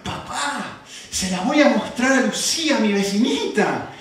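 lightly voiced little girl; daughter's voice. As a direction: say it like an eager child. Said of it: "me dijo esto".

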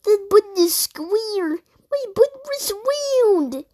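high-pitched, wailing toad complaint. Acted. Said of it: "voicing Toad".

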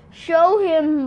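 lightly voiced pleading snake. A narrator's reading. Snake begs the jogger, mildly pleading. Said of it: "Mara pleaded".

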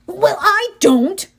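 Grover, annoyed at being left out, complaining slowly. A narrator's reading. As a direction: whine it like a left-out friend. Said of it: "Grover complained".

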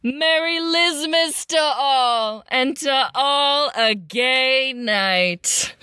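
slow, drawn-out closing exclamation; exclaiming. A narrator's reading. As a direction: ring it out slowly like a storybook Santa. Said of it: "they heard Liz exclaim".

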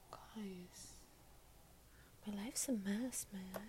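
hushed whisper to viewers; whispered confession. Real, not acted. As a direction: breathe it out like a secret.

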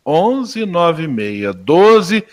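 very slow, each digit drawn out; repeating the digits slowly while noting the number; slow note-taking repeat. Real, not acted. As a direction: say the digits one by one as you write. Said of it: "deixa eu tomar nota".

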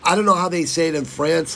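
usual speaking voice

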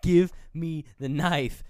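menacing slow order; slow demand. Retold or imitated. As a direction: spell it out firmly for him. Billy demanding the knife.

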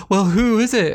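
high, loud mock-shocked question; mock surprise. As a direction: act out an over-eager, startled question. Acting out cartoon gasped question.

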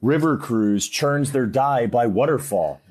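Willy Wonka. Acted. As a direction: draw it out like an eccentric showman proudly boasting. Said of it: "that's my Willy Wonka impression".

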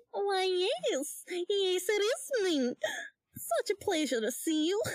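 high, cutesy cartoon lady; coy, high-pitched welcome; Betty Boop. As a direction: play a little cartoon sweetheart. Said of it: "I'm trying to be, uh, Betty Boop".

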